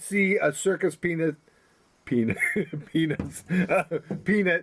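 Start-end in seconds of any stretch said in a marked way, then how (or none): 2.0-4.6 laughing through words. Real, not acted. Cracks up over circus peanuts.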